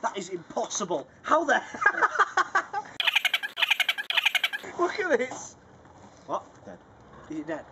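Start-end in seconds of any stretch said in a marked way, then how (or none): 1.7-4.7 high-pitched burst of laughter; loud laughter. Real, not acted. Can't believe the stuffed mouth.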